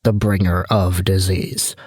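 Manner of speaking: low, slow mock-grave proclamation; ominous plague figure. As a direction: announce yourself as doom incarnate, low and drawn out. Mock-grave self-introduction as a plague.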